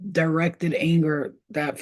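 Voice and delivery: ordinary talk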